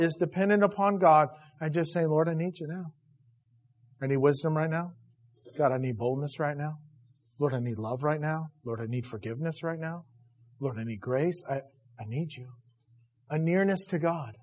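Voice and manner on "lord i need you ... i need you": softer, lower humble petition; gentle lowered plea to God; quiet prayer. Acted. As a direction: pray simply, without begging. Voicing his own quiet prayer.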